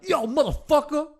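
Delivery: high, mock-intimidating gangster voice; hammed-up gangster insult. Shown as a demonstration. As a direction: throw it like a street tough. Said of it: "it sounds so intimidating".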